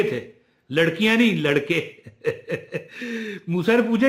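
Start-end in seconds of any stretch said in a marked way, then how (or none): 2.2-3.4 short amused chuckle to yourself; real soft laughter. Real, not acted. Amused at the many sons.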